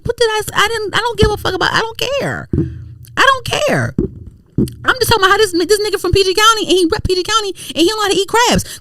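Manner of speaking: high-pitched exasperated retort; worked-up squeaky indignation. Retold or imitated. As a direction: protest it way up high, fed up. Acted exasperated reply to commenters.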